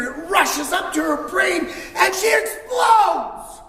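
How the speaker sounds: climbing to a high loud shout, slowing on "explodes"; building to explosion. A narrator's reading. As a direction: ramp up until she explodes. Narrating her explosion.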